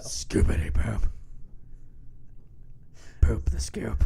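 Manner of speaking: whispered creepy lyric; slasher killer. Pretend. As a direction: breathe it like a serial killer. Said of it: "Sound like a serial killer".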